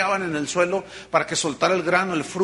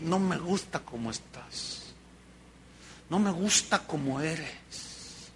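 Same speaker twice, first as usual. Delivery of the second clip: much softer, slow loving rebuke; tender; 3 semitones lower, 11 dB quieter, slower than usual. Acted. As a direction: speak it gently as a loving look. Jesus's loving gaze, tender.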